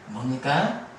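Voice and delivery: ordinary talk, nothing marked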